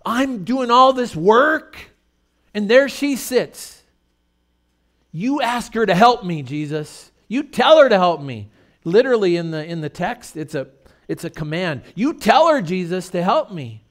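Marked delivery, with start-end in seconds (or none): 0.1-8.5 high, loud, slow indignant whine; whiny complaint. Retold or imitated. Martha demanding Jesus make her sister help, high and whiny. 12.0-13.8 high, loud demand on her; indignant command. Retold or imitated. Martha commanding Jesus to send her sister, high and loud.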